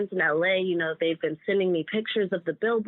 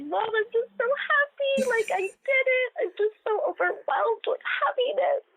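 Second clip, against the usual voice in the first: high, teary voice breaking with joy; happy crying. Retold or imitated. To her mom, retelling happy tears, crying high. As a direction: cry it out, overwhelmed with happiness. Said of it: "I took a video of me crying".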